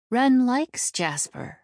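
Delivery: high, slow character voice; Wren's simple vote. Acted. Wren adding his vote for Jasper, high and slow.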